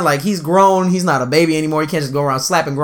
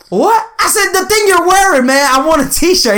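high-pitched, exasperated comeback; high, indignant retelling of an argument; exasperated retort; 11 semitones higher than usual. Retold or imitated. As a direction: spell it out in mock exasperation, pitched way up. Acted loud exchange about the t-shirt.

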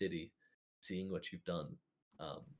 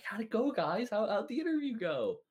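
high, loud, cheery call; perky greeter; 13 semitones higher, 6 dB louder than usual. Acted. Receptionist waves over the returning adventurers, perky high voice. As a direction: call out eagerly in a much higher voice.

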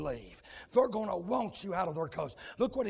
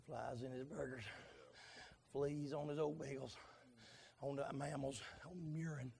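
hushed preaching; 7 semitones lower, 11 dB quieter than usual. Acted. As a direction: drop to a near hush. Drops to hushed after shouting.

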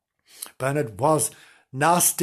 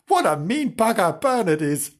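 plain reading voice

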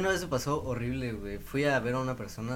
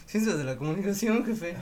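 higher-pitched sheepish answer, lightly acted; meek retold reply; 7 semitones higher, 4 dB louder than usual. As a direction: act out answering the professor, pitched up. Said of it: "pues, yo".

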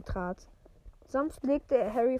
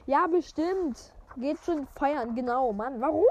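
sarcastic; 3 semitones higher, 4 dB louder than usual. Real, not acted. Reader scoffing at the wizards going to parties. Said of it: "reader mocks going to parties".

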